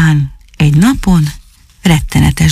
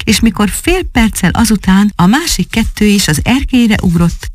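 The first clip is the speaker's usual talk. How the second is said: ordinary delivery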